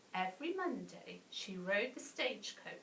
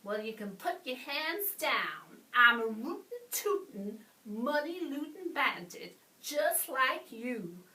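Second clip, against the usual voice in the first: loud, boastful cowboy bandit swagger; 3 semitones higher, 5 dB louder than usual. A narrator's reading. Bert to the bandits, claiming to be one of them, bragging. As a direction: brag it out in a bandit's voice. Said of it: "Bert bragged".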